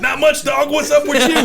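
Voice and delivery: high, loud, laughing punchline shout; gleeful shout. Retold or imitated. Telling coworker the Up Dog punchline, a high laughing shout. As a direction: yell the dad joke punchline with a laugh.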